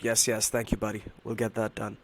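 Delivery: ordinary delivery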